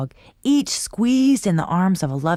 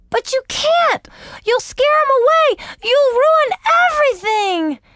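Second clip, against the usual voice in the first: put-on high, loud panicked child; frantic shout; 5 dB louder than usual. A narrator's reading. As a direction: shout in alarm to stop him. Sister frantic.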